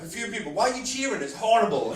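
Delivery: ordinary talk, nothing marked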